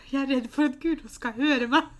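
ordinary delivery

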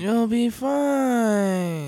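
very drawn-out high, loud mock reassurance; imitated boat guy. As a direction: stretch every word way up high. Co-host continues the boat man impression.

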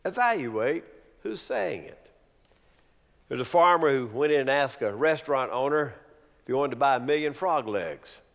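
ordinary talk, nothing marked

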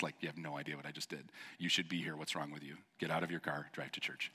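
a half-joking mutter; mock muttered scold. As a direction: grumble it under your breath at absent listeners. Mock scolding of podcast listeners.

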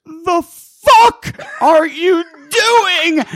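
very loud, high, drawn-out scream; tearful screaming rage. Acted. Enraged homeowner confronts worker over the hole, screaming near tears. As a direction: scream each word slowly, near tears. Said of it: "about to bust out in tears".

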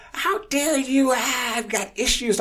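high, loud, drawn-out shriek; exaggerated falsetto outrage; mock screaming. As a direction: imitate an outraged woman screeching. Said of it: "screaming at me".

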